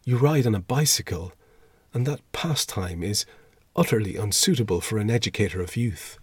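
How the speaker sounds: plain reading voice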